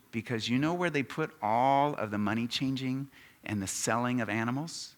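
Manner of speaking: ordinary delivery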